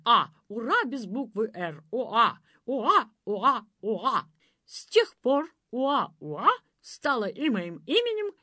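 loud, bouncing rhythmic verse; proud recitation. A narrator's reading. Prince recites his grandfather's story, proud declaiming. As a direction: declaim it like a boastful battle rhyme. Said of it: "приняв гордую позу, одним духом выпалил".

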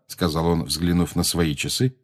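plain reading voice

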